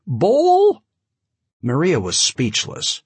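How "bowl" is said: high, loud Yiddish-tinged voice; booming high-pitched big man; high-voiced giant. A narrator's reading. Giant calls at the door.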